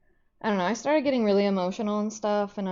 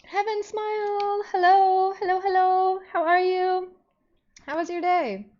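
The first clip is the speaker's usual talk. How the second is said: much higher, louder sing-song hellos; chirpy greeting voice; 11 semitones higher, 6 dB louder than usual. Real, not acted. Streamer greeting viewers Ted and Bean, high chirpy hello voice. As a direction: greet them like excited friends, way up high.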